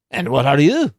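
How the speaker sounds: put-on gruff dwarf in a hammy Scottish accent; dwarf on the plain, suspicious of the stranger, bad Scottish accent. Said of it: "that's a bad Scottish accent".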